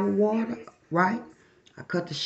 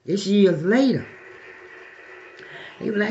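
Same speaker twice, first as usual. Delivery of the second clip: ordinary talk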